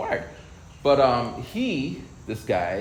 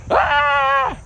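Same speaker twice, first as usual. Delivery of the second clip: much higher, louder startled yell imitating dad; dad's shout; 8 dB louder than usual. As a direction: blurt it like a dad freaking out. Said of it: "he'd just be like".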